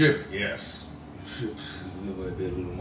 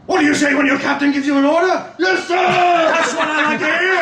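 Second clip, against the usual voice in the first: very high, very loud bellow; roaring first mate; 18 dB louder than usual. Acted. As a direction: bellow orders at the crew. First mate roars at the crew.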